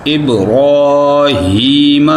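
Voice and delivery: ابراہیم slow, with a full heavy r. Shown as a demonstration. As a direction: thicken the r in ابراہیم. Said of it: "را کو موٹا کرنا ہے".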